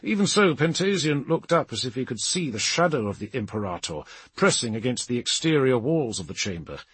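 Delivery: ordinary delivery, nothing marked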